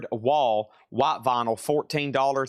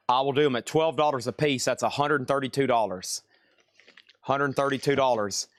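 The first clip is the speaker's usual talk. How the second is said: normal talking voice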